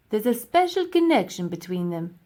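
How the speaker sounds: lightly voiced pompous professor; a hint of an old scholar, marvelling.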